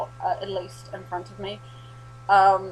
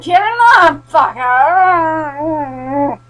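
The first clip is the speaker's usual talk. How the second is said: very loud, high battle yell; battle shout; 8 semitones higher, 14 dB louder, much slower than usual. Retold or imitated. Charging the zombie pack, she yells as loud as she can. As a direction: roar it as you charge through.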